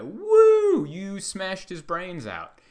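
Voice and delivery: whooping high, loud wrestling crowd; cheering wrestling fan. Retold or imitated. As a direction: hoot like a rowdy fan, stretching the words out. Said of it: "wrestling show where they're like".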